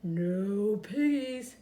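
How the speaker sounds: slow, lowing cow; slow, drawn-out cow reply; mooing cow. A narrator's reading. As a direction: moo the words slowly. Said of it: "mooed the cow".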